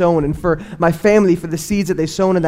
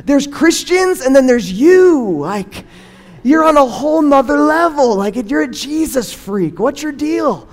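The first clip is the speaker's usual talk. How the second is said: put-on higher-pitched, put-upon teenage exasperation; exasperated teen; 6 semitones higher, slower than usual. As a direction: roll your eyes at Dad, dragging out the disbelief. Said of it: "I remember thinking to myself".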